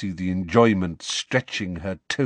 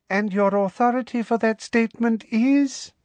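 much higher, sugary and pointed; Umbridge's icy challenge; 13 semitones higher than usual. Acted. Umbridge challenging McGonagall, high, sugary and pointed. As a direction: demand it with prim menace.

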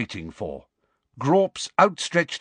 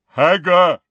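higher, louder giant's demand; insistent giant; 6 semitones higher, 9 dB louder than usual. A narrator's reading. Giant searching for someone he lost, insistent loud call. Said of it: "more insistently".

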